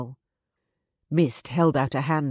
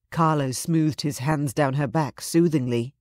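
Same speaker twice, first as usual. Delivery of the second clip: ordinary delivery, nothing marked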